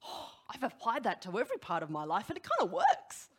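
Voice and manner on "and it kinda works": high squeak. Retold or imitated. Punchline of a quoted colleague.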